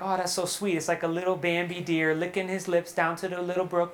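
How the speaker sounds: soft, low, mock-sweet musing; gushing sweetly. Preacher mimics his own cosy reading of the verse, gushing sweetly. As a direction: coo it quietly as if over a cute picture.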